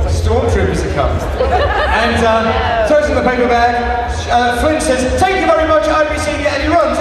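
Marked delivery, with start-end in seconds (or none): 5.2-6.5 triumphant farewell. Acted. Irish brother leaving shop triumphantly.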